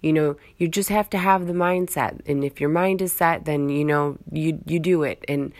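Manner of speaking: ordinary talk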